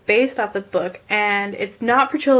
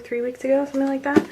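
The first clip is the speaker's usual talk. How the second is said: normal talking voice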